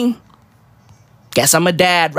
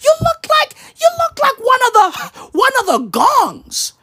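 high, shouted greeting; excited stranger; 14 semitones higher than usual. Retold or imitated.

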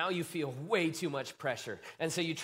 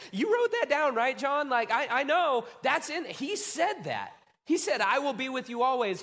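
much higher and louder, incredulous; baffled disciple; 8 semitones higher, 4 dB louder than usual. Retold or imitated. Disciple asking John about Jesus's promise, incredulous. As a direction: protest like you were promised something. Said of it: "some of those disciples were like".

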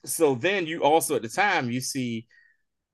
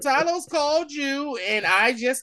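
higher, slow, laughing mock retort; bitter correction; 10 semitones higher, 3 dB louder than usual. Maria Hill telling Fury she only picked him up, higher bitter retort. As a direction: set him straight with a sneer.

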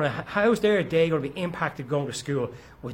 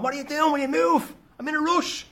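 road rage yell; 9 semitones higher than usual. Retold or imitated. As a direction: shout impatiently through the windscreen, half acted.

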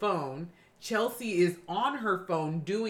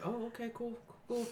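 soft, hushed going along with it; quiet retold awkward reaction; awkward acceptance; 10 dB quieter, faster than usual. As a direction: play it small and noncommittal.

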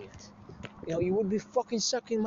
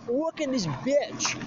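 scornful disbelief; 6 semitones higher, slower than usual. Retold or imitated. Indignant inner thought, retold.

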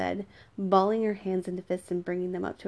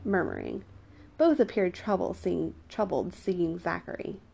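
ordinary delivery

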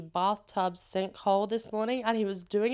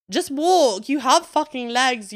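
loud, higher, scolding; exasperated rant; 4 semitones higher, 9 dB louder than usual. Acted. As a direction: yell it at the scooter riders. Ranting at scooter riders.

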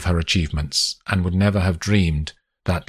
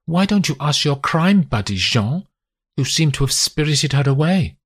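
higher, sharp accusing wife's voice; 9 semitones higher than usual. A narrator's reading. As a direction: voice a betrayed mother, pitched up and pointed. Wife voiced confronting husband.

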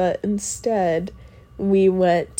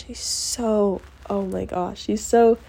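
ordinary talk, nothing marked

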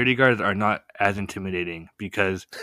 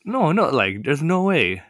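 pitched-up no no, retold disbelief; high disbelieving denial; 6 semitones higher, slower than usual. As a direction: protest it higher, in mock shock. Denying bullet in backpack.

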